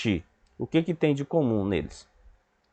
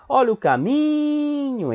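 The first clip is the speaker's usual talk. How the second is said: high, long drawn-out MI in "caminho"; street vendor call; 13 semitones higher, slower than usual. Shown as a demonstration. Teacher showing pupils the stressed syllable, sung-out vendor cry. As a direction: hawk it like a street seller. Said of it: "faz o vendedor".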